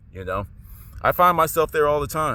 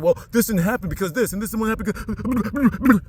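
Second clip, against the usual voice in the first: mocking voice; 6 semitones higher than usual. Mocking a rationalizing skeptic.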